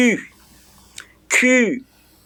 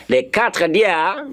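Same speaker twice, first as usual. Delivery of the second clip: ordinary talk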